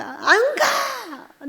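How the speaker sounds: slow, drawn-out high cry; wailing cry. Retold or imitated. Refusing to leave heaven, a long wailing cry. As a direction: stretch the refusal into a wail. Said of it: "내 울음소리".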